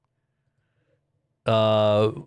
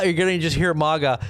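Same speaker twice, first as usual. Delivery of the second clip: ordinary delivery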